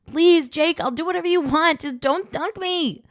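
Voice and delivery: high, loud screamed begging; about to be dunked, she screams a plea to Jake; screaming plea. Acted. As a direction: scream it, bracing for cold water. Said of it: "I screamed".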